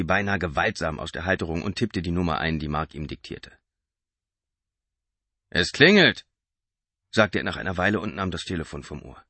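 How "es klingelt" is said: higher, louder driver voice; gruff driver. A narrator's reading. Driver telling Mark the phone rings, higher. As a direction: state it bluntly.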